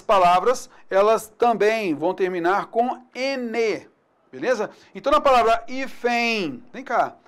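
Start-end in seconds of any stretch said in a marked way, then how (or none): none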